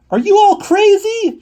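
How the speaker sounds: very high, loud, slow scream. Acted. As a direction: scream at everyone. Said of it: "she screamed".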